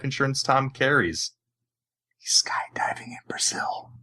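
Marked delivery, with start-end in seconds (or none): 2.2-3.9 conspiratorial whispered aside. Pretend. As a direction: whisper it like a secret. Joking aside about Tom.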